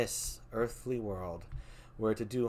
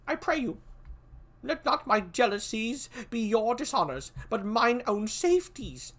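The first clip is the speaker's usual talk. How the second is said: put-on much higher, cautious young prince; young Malcolm; 9 semitones higher, 4 dB louder than usual. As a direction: weigh each word warily, pitched high.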